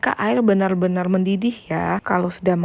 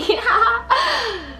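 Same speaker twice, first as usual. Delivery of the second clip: short bright laugh; a happy, pleased giggle. Real, not acted. Pleased with her own cooking.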